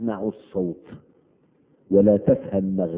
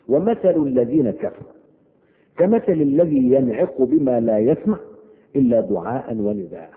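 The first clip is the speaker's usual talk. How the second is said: raised, chanted verse recitation; Quran recitation; 4 semitones higher, 4 dB louder than usual. Acted. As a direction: intone the verse like tajweed.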